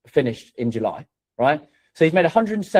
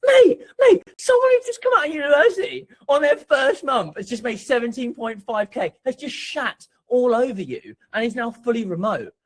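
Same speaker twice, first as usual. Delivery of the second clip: high shouted "mate, mate" that settles into a raised mocking rant; 9 semitones higher, 3 dB louder than usual. A mate mocking a corporate salesman, shouting high. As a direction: yell it at them like a gloating colleague, then ease off.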